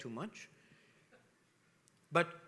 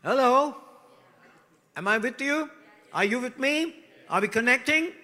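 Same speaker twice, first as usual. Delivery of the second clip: higher and louder, checking the crowd is with him; rousing call; 7 semitones higher, 9 dB louder than usual. Real, not acted. Preacher calling out to congregation, loud and rousing. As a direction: wake the room up. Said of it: "calling to the congregation".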